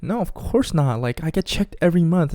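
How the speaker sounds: high-pitched mock denial; imitating the girl. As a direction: play the girl insisting she's clean. Voicing the girl's denial.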